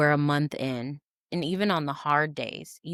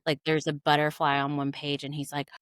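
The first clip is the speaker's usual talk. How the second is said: usual speaking voice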